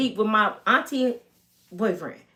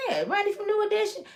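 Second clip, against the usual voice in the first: lightly voiced neighbor; 10 semitones higher, 5 dB quieter, faster than usual.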